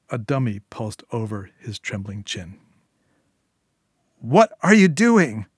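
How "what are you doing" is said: high, loud, drawn-out scream; outraged scream. Acted. Julia screaming at Syl who holds her baby, outraged. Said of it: "Julia screamed".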